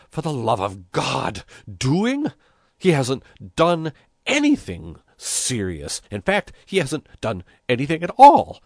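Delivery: exasperated, rising incredulity; worked-up, emphatic complaint; indignant protest. A narrator's reading. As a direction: protest with growing volume and pitch. Mayor protesting indignantly to bishop.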